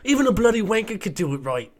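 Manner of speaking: angry fake British accent; mock British accent. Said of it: "Is that his accent?".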